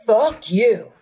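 hammed-up snap at a cocky newcomer; retold angry outburst. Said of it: "And he was like".

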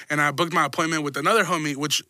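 ordinary talk, nothing marked

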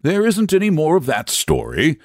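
mild grown-up firmness; kindly but firm. A narrator's reading. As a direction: close the matter kindly, allowing no argument. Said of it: "said the man kindly but firmly".

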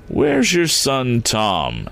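sharp question. A narrator's reading. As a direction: ask it sharply, drawing it out. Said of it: "asked the head of the Shopton police force sharply".

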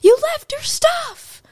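high, drawn-out holler, imitated; calling out down the hall. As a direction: shout it after someone walking away. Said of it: "the guy yelled back".

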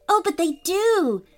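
put-on high, pleading child voice; eager childlike insisting; insistent little girl. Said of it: "insisted Poppy".

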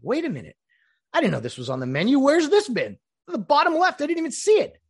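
high, loud disbelief at what turned up; astonished discovery. Acted. Diner spotting the perfect dish on the menu, astonished. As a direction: burst out incredulous, pitch leaping way up.

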